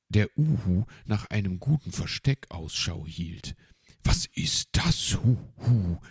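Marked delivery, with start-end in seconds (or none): 4.0-6.0 loud, high, alarmed owl; calling out. A narrator's reading. Uhu hearing strange noises in the house, calling out. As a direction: cry out with a hoot at the end. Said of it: "rief er".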